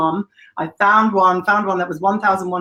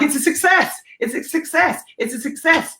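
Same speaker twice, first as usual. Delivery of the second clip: mock excited; 9 semitones higher than usual. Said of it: "I was like".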